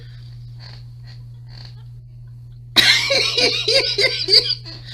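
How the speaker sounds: high, wheezy cackling laugh; hard real laughter. Real, not acted. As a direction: crack up helplessly. Watching painful video clips, cracking up.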